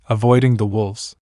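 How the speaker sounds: ordinary delivery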